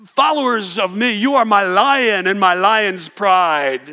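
slow, loud, grand proclamation; hammed-up divine voice; mock grand God. God booming to his followers, mock grand. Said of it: "God doesn't describe us as".

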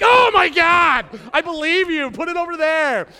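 loud, high flustered yelp; half-laughing loud disgusted outcry; alarmed shout. Retold or imitated. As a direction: recoil and yell at the pee stick. Test held to his face.

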